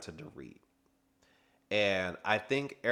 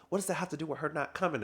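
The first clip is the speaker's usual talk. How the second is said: quick retort; 7 semitones higher, faster than usual. Retold or imitated. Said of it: "And Dorit was like".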